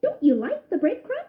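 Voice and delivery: high cheerful mouse voice; friendly young mouse; Ced voice. A narrator's reading. Ced speaking.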